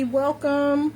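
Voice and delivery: ordinary talk, nothing marked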